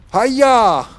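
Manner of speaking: high, loud battle cry; sudden high-pitched attack shout; mock karate yell. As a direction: yell it like a play martial-arts strike. Mock martial-arts attack cry in game.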